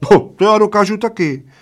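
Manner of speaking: high squealed retort; little man's high shriek. A narrator's reading. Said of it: "zaječel maličký".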